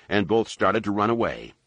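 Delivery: ordinary delivery, nothing marked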